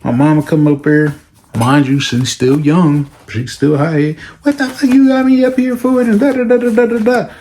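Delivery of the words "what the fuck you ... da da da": much higher, brash ranting mother; angry mom. Retold or imitated. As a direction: tear into the principal. High mother yelling at staff.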